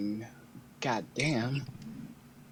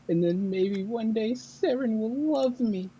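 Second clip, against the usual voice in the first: lovesick daydream; 11 semitones higher, 4 dB louder than usual. Acted. Wistful lovesick joke.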